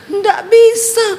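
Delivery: high, dragged-out possessed voice; mouth held shut. Retold or imitated. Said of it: "ditahan sama iblis mulutnya". (suara kerasukan tertahan, tinggi dan diseret)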